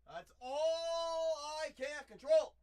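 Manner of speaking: loud exclaim. Real, not acted. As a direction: belt it out, drawn out. Exclaimed after calm lines.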